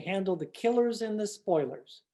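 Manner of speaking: put-on booming old-time radio show opener; radio announcer. Announcer opening the Western radio drama, grand announcer read. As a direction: announce the show to the listeners with gravity.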